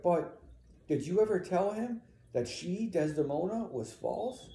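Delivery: high, halting question; puzzled, needing an answer; confused questioning. Acted. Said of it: "And Amelia's confused now".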